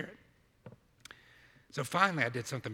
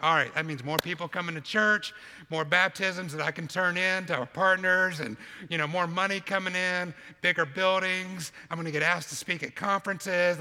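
higher, louder, mock-eager list of hopes; eager excitement; 4 semitones higher, 7 dB louder than usual. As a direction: rattle off big dreams with hammed-up eagerness. Acting out eager church planter's hopes.

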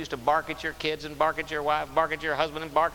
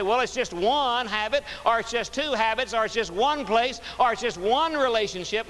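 higher pleading voice, stressing "one"; mock excuse; 8 semitones higher, 4 dB louder than usual. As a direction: shrug it off, it's just one, pitched up high. Mimicking people excusing habits.

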